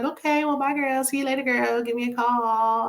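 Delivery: high, sing-song fake friendliness; imitating Letitia's fake-nice goodbye; mock fake-sweet goodbye. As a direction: chirp a phony sweet farewell. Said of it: "And Letitia was like".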